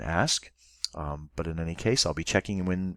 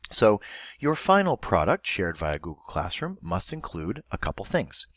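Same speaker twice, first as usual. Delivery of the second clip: ordinary talk, nothing marked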